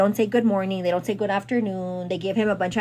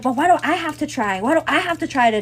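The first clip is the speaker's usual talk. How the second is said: higher, louder pleading complaint; retold frustrated whine; 6 semitones higher, 4 dB louder, faster than usual. Boyfriend complaining to her about unkind coworkers, louder and higher.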